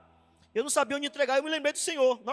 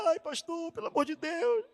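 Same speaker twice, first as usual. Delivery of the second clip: desperate sister; 3 semitones higher than usual. Retold or imitated. Desperate church sister begging pastor.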